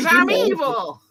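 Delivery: evil gloat. Acted. Villain brag.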